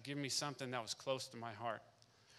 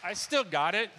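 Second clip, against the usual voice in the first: proud excited; 6 semitones higher, 10 dB louder than usual. Real, not acted. Showing off the keepsake.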